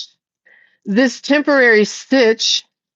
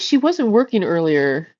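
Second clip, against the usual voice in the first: ordinary talk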